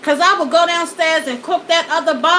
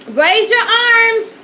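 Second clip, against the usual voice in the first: put-on higher, louder and slow, like calling to a child; parent command; 7 semitones higher, 5 dB louder, slower than usual. Mom telling her choking kids to raise their arms, loud command. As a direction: bark the order at a choking kid. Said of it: "how many of y'all told y'all kids this?".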